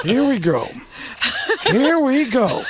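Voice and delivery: exasperated star, rising on the repeat; Al Pacino. Retold or imitated. Star dreading the encounter, exasperated impression. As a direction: say it resigned, then push it up high. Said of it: "the Pacino looked and went".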